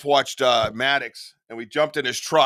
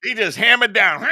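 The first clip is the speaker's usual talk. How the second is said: ordinary delivery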